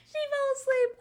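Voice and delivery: loud, very high squeal of disbelief; incredulous shriek; high-pitched disbelief. Real, not acted. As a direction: squeal it, cracking up. Cracking up in disbelief.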